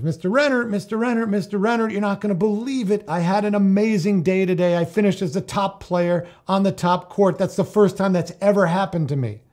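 high, loud, bubbling over with excitement; a thrilled teen calling his coach; giddy excited kid voice. Retold or imitated. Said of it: "oh, very excited".

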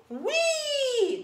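high gleeful squeal; cartoon princess. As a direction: squeal with joy like a little girl. Princess playing in the mud.

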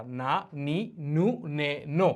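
না, নি, নু, নে, নো evenly separated; syllable drill. Shown as a demonstration. Teacher presents the n sounds, syllable by syllable. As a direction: recite না নি নু নে নো for repeating. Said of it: "এরপর হলো কি না সাউন্ডটা".

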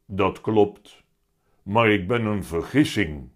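loud, weighty cat voice; heavy-voiced talking cat. A narrator's reading. The cat explains himself to Willem, loud heavy voice. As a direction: pronounce it grandly as a big cat. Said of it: "met zware stem".

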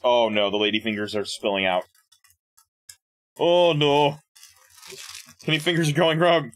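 normal talking voice

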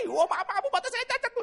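high, loud hurried sorry; flustered apology. Retold or imitated. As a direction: caught out and apologising fast. Flustered apology in women's toilet.

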